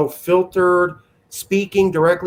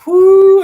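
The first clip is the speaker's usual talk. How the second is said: a sharp whistled whew, high and loud; 11 dB louder than usual. Real, not acted. Host reacts to golf stress with loud whew. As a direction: blow out a big high whew.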